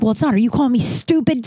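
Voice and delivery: ordinary talk, nothing marked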